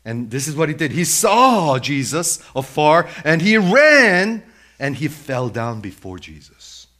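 impassioned pulpit storytelling; full-force preaching. Acted. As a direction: preach the story loudly, stretching key words.

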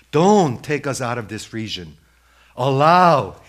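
loud acted plea; demons' loud entreaty; begging. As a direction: beg out loud, leaning on the last word. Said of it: "they begged, saying".

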